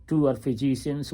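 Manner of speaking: ordinary talk, nothing marked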